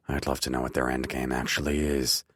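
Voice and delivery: ordinary delivery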